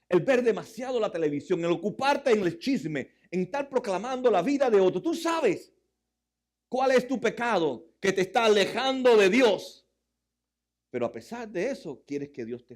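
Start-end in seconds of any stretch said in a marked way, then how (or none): 0.1-9.9 loud, high preaching rebuke. Acted. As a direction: call out their sins from the pulpit.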